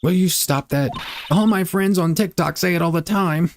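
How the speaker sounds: high-pitched scolding voice; scolding game character. Acted.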